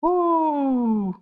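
high falling "woo"; exclaimed whoop. Real, not acted. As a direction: let out a long, high woo, sliding down. Exclamation in preaching.

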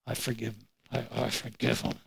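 very low, hushed grudging concession; reluctant, barely audible forgiveness; through gritted teeth. Retold or imitated. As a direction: force it out under your breath, teeth gritted. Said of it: "I did it through grit teeth".

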